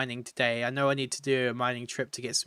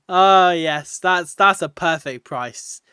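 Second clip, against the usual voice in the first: excited; 3 semitones higher, 8 dB louder, slower than usual. Real, not acted. Joking about absurd pickle price.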